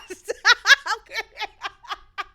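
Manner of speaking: high drawn-out laughter; long loud laugh. Real, not acted. Still cracking up at the interview memory, loud laugh. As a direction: let the laugh roll on. Laughing after her reaction.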